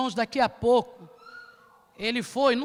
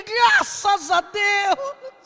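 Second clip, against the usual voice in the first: slow relieved exclaim; 6 semitones higher, 6 dB louder, much slower than usual. Retold or imitated. Relieved man, she moved.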